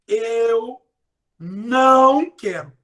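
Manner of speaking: não stretched slowly and loud; stress on não. Shown as a demonstration. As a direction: lean hard on não, slow. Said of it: "eu usei a palavra não". (ênfase no não, bem devagar)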